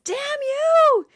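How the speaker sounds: sudden high shouted curse; yell. Retold or imitated. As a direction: throw a hammed-up fit. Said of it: "and he yells".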